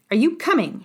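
understated shriek, pitch jumping up; mild narrated shriek. A narrator's reading. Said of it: "shrieked Madame Thénardier".

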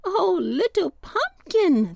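high, slow, drawn-out storybook call; breezes crying out. A narrator's reading. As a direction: call out fondly, high and unhurried. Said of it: "they cried".

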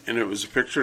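usual speaking voice

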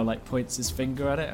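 ordinary talk

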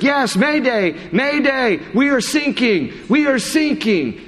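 high panicked repeated cry; frantic mayday. Retold or imitated. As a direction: shout it like the boat is going under. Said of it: "And he's like".

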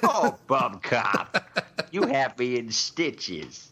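slow, laughing delivery; the chief, laughing slowly at Bum Cop; mock laughing chief. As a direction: laugh your way through the line, slowly.

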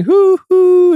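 high loud excited hoo hoo; loud high copycat hoot; child copying train. Retold or imitated. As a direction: toddler hooting like the train. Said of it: "she started going".

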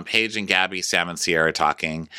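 ordinary talk

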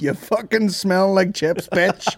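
mock insult shout. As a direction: yell the insult for laughs. Mock roasting online haters.

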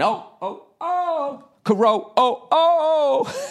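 high squealing cries rising to a shout; hammed-up hysterical squeal; mock fangirl shriek. Imitating teenage girls screaming at the latest boy band. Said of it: "acting like a bunch of fucking teenage chicks".

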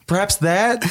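normal talking voice

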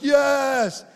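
sudden high shout of agreement; shouted yes. Retold or imitated. A room of worshippers shouting yes together.

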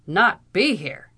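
loud, incredulous Irish question; indignant echo. A narrator's reading. Captain throwing back the lawyer's words, indignant. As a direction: fling the words back loudly. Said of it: "repeated the captain".

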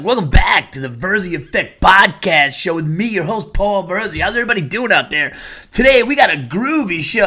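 over-the-top retro radio announcer; cheesy radio DJ. Retold or imitated. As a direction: ham up an old-school radio DJ intro. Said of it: "Imagine I talked like that".

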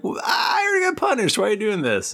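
high, fast indignant whine; high-pitched mock complaint; whiny teen protest. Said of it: "Harry's like".

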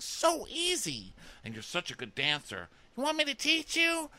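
high-pitched hammed-up flirting; gushing contestant. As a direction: squeal it like a flirty reality star.

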